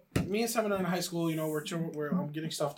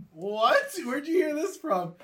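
louder, rising, acted disbelief; incredulous; 6 semitones higher, 3 dB louder, slower than usual. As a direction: open loud, then stretch the question up high. Retold shock at the rumour.

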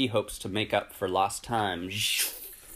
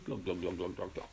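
glugging noise; 3 semitones lower, 9 dB quieter, faster than usual. Acted. Imitating the gas pump.

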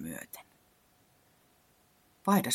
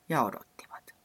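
whispered. A narrator's reading. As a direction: whisper it, waiting on and on. Second repeat whispered.

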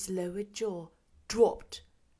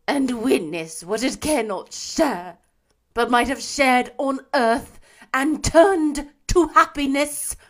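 mournful ghost; 4 semitones higher, 11 dB louder than usual. A narrator's reading. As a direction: lament like a chained spirit doomed to wander. Marley's ghost voiced throughout.

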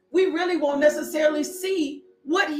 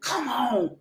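usual speaking voice

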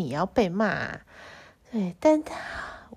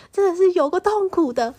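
exasperated; 9 semitones higher, 6 dB louder than usual. Real, not acted. Complaining about the ordeal.

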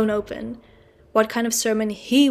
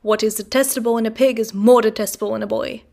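louder, churchy declaiming voice; theatrical declamation; 6 dB louder than usual. Acted. Mr Wopsle moralising at the boy, theatrical. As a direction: thunder it like a pulpit moral.

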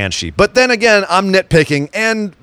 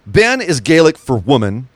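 normal talking voice

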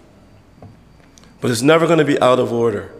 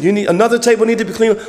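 quick, higher call across the room; 6 semitones higher, faster than usual. Retold or imitated. A church member calls out quickly that another table needs cleaning. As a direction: hurry someone to the next table.

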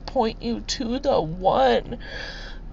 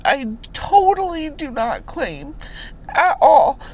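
real tearful outpouring, pitched high; high, emotional, close to crying; blubbering; 5 semitones higher than usual. Real, not acted. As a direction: push the words out through real tears. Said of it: "I'm just a blubbering".

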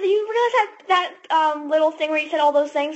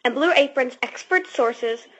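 ad read; 4 semitones lower than usual. Acted. Reads ad copy.

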